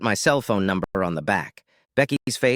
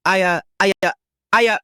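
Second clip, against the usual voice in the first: flustered higher-pitched stammer; 9 semitones higher, 5 dB louder, faster than usual. A narrator's reading. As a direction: stumble over the first word, at a loss. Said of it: "she stammered".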